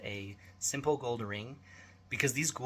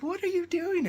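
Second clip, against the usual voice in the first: high, louder shocked voice; appalled wife; 6 dB louder than usual. Retold or imitated. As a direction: play the wife, horrified. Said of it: "My wife's like".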